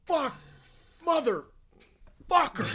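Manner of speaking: high, loud, anguished shrieks; mock pained shriek. Man hit in the shin by a golf ball, high shrieking. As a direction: squeal it like you've just been hit.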